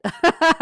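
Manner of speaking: real high, loud burst of laughter; laughing at self. Real, not acted. Streamer remembering recording in a closet, real self-amused laugh. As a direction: crack up at your own story. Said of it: "I'm laughing at myself".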